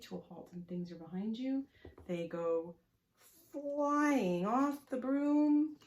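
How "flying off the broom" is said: loud, high, stretched-out flying; dramatic narration. A narrator's reading. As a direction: draw it out like a long fall. Riders thrown off in the story.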